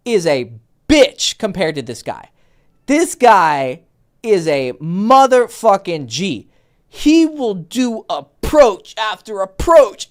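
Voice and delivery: ordinary delivery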